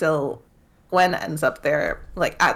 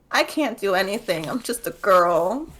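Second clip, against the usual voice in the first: mock helpless high whine; lightly hammed-up damsel; whiny princess; 5 semitones higher than usual. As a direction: play the helpless little princess, mockingly. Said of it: "And Gwen's like".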